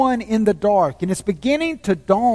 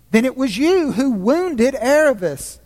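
put-on higher, wondering boy's question; puzzled boy; 5 semitones higher than usual. A narrator's reading. Shasta asks the voice, puzzled and high. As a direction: ask it high and bewildered.

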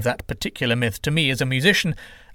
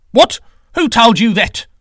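sharp high loud outraged yelp; angry retort; 10 semitones higher, 10 dB louder than usual. Acted. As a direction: fire back, stung and furious. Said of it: "Quetzalcoatl retorted angrily".

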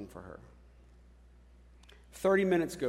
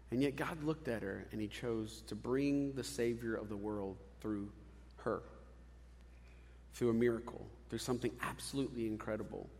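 quiet tender preaching; 5 semitones lower, 6 dB quieter than usual. Acted. Soft reflective preaching.

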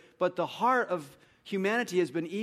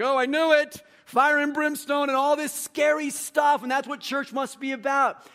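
high, loud hammed-up skeptic; mock visitor alarm; 11 semitones higher, 8 dB louder than usual. Preacher mimicking a newcomer hearing about repentance, mock alarm. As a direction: gasp as if your fears came true.

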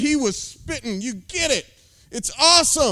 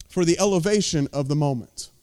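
ordinary delivery

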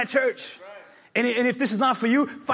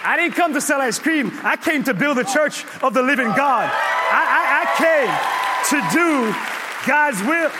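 loud, high preaching climax; preaching shout; 5 semitones higher, 6 dB louder than usual. Real, not acted. Pastor shouting his calling to the church. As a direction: shout it from the pulpit.

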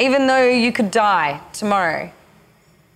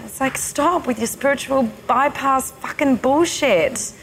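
higher, pleading and hurt; higher hurt plea; vulnerable sadness; 5 semitones higher than usual. Retold or imitated. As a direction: beg to be felt. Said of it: "she went into her vulnerability".

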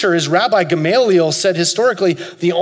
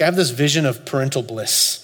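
ordinary talk

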